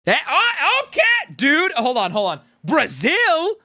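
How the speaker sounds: startled, high-pitched burst of disbelief; a sudden, loud yelp of surprise; real shock. Real, not acted. Streamer reacting to his viewers' countries, startled and loud.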